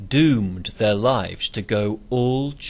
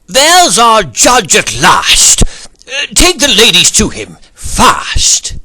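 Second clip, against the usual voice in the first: booming command; 10 semitones higher, 10 dB louder than usual. A narrator's reading. Zeus ordering Hermes.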